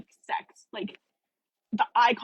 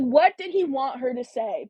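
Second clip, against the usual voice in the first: usual speaking voice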